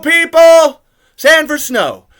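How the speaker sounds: loud, high, furious yelling; shouting in disbelief at the movie; outraged shout. Real, not acted. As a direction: rant at the screen about the filter. Ranting about the snow filter.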